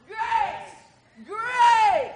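high, drawn-out shout of "Grace!"; shouted command. Acted. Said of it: "I want you to shout".

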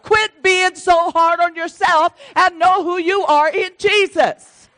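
high, loud, exhorting sermon voice; forceful preaching. Real, not acted. Preacher urging believers to know who they are, forceful and exhorting. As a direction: drive it home to the congregation.

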